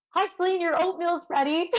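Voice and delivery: high, bright-voiced wake-up call to a sleeper; cheery wake-up call. Aid station captain waking the runner, high cheery greeting. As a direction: sing it out like waking a kid with breakfast.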